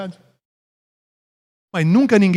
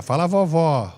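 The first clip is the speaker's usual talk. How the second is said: doting grandmother; 4 dB quieter than usual. Acted. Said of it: "vó Coruja".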